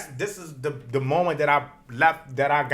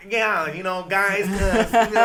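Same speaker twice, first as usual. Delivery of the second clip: code-switched voice; 5 semitones higher, 7 dB louder, slower than usual. Retold or imitated. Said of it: "I thought I had to be that".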